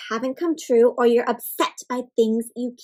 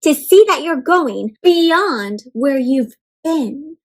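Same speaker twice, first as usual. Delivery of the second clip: slow, louder storybook read-aloud; reading to children; 8 dB louder, slower than usual. Acted. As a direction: read it slowly with wonder. Reading picture book aloud.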